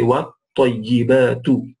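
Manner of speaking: slow what tayyibatu with a flat waw; correct pronunciation demo. Shown as a demonstration. Teacher modelling the right reading of what tayyibatu, slow careful pronunciation. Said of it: "the waw is flat".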